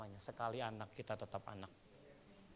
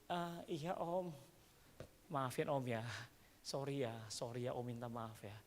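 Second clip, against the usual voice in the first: gentle apology; 2 semitones lower, 7 dB quieter than usual. Retold or imitated. Apologising to child.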